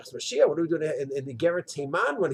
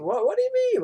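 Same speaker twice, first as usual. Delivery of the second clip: indignant; 14 semitones higher, faster than usual. Retold or imitated.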